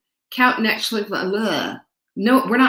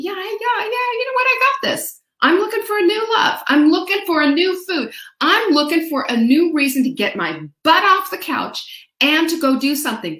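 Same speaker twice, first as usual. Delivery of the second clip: much higher, bubbly and eager; pumped-up excitement; 7 semitones higher than usual. Retold or imitated. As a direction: hype yourself up off the couch. Voicing pumped-up inner thoughts.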